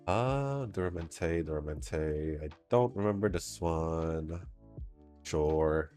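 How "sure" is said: normal talking voice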